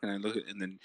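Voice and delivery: usual speaking voice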